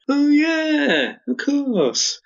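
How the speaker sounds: drawn-out, high-pitched "oh yeah"; delighted reaction. Retold or imitated. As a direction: squeal it in mock delight, stretching the words. Acting out their delighted reaction.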